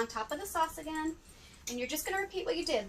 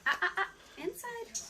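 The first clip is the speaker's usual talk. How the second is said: high-pitched warning to the dogs; correcting pets; 7 semitones higher than usual. Real, not acted. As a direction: stop them with high little warnings. Scolding the dogs back inside.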